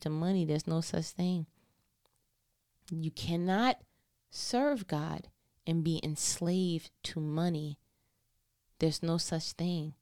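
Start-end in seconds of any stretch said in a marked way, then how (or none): 2.9-9.9 slow, spaced out, low; grave emphasis. Real, not acted. Preacher restates the warning to congregation, slow and deliberate. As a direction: set down "cannot", "serve God" and "money" one weighty piece at a time.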